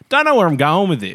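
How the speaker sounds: 높고 크게 던지는 속마음; 살짝 당황한 척 외치듯; mock panic. Quoting his own inner thought.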